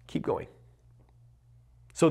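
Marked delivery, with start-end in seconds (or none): 0.1-0.5 hushed inner pep talk; quiet self-talk. Retold or imitated. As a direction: murmur it to yourself to push on. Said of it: "I literally just said".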